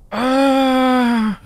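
sharp high cry of alarm; panicked cry. Real, not acted. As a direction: yelp out in shock. Character hurt in the game.

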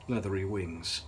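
plain reading voice

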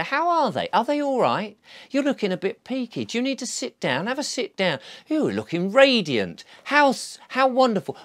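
a caring stranger fussing over a uniformed official; fussing kindness. Retold or imitated. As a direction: fuss over them like a kindly aunt. Friendly chat with a stranger.

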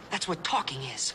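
ordinary talk

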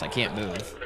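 normal talking voice

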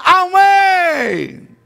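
high, jubilant cry of amen; congregation's joyful shouted amen. Pretend. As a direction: shout it like the whole church is jumping. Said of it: "las pamelas vuelan y usted grita".

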